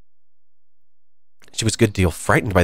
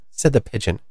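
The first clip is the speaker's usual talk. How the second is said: usual speaking voice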